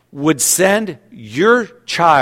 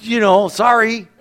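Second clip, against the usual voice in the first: casually turning a friend down, pitched up; mock refusal; 7 semitones higher than usual. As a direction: pastor voicing his own refusal, higher and brighter. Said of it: "I'm like".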